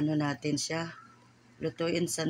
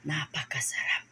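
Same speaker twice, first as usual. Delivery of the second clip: whispered delight. Real, not acted. Cook whispering to viewers how tasty it is. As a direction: whisper it in awe. Tasting the dish, savouring.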